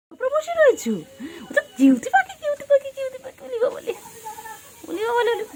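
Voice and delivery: very high squeal of adoration. Real, not acted. Squealing at cute pet. (খুব উঁচু গলায় আদুরে চিৎকার)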